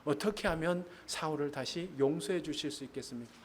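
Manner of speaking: ordinary talk, nothing marked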